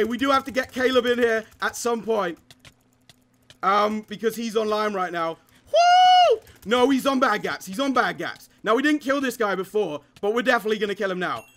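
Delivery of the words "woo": very high whooping cheer. Real, not acted. Gamer whooping as the teammate arrives online. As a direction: let out a joyous whoop.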